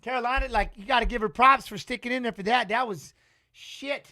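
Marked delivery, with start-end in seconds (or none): none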